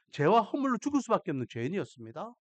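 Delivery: ordinary delivery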